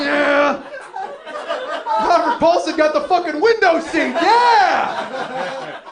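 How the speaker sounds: high, full-force celebratory shout; hammed-up ecstatic bellow; triumphant yell. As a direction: roar it like a victory chant. Mock triumphant Fight Club cheer.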